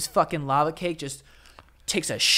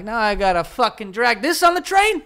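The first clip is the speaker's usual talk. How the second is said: louder, rising incredulous gripe; exasperated mock complaint; 6 semitones higher, 3 dB louder than usual. Worker facing a train ride with gift, exasperated. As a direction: balk at it, voice climbing to the end. Acted reaction to gift at work.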